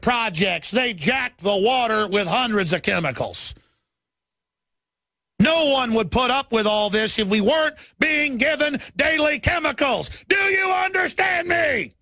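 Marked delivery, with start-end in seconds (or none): 5.4-11.9 high yelling demand, slowing on the question; furious high yell; angry shout. Real, not acted. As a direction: bellow it at the listeners. Ranting about chemicals.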